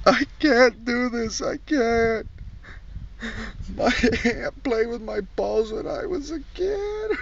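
high, slow whimpering plea; mock weepy confession. Comic mocks a criminal crying about abuse, high whine. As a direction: whine it like a fake crier.

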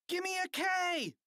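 high, drawn-out cheer chant; cheerleaders' shout. A narrator's reading. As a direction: lead the crowd in a high-pitched cheer. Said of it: "shouted the cheerleaders".

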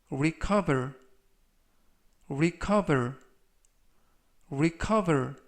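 careful "recover" with a falling end; slow model reading. Shown as a demonstration. Teacher reading vocabulary for students to repeat, slowly. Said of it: "천천히 세 번씩 읽어드리고요".

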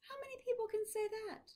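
put-on high, quick old professor; Maury voice. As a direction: lightly voice the professor. Voicing Maury, fast.

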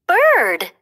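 "Bird" called high and loud; calling out. A narrator's reading. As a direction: call "Bird" out proudly, full voice. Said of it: "Bambi called again".

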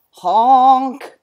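loud, drawn-out honk; monkey honking. Acted. Proboscis monkey sounding his hooter, honking. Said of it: "monkey honking his hooter".

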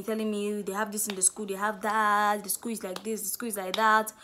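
ordinary talk, nothing marked

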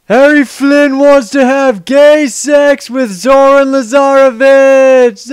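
a long, booming mountaintop shout. Acted. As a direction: bellow it so the whole world hears. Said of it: "We can shout anything we want".

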